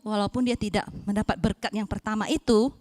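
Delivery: ordinary delivery, nothing marked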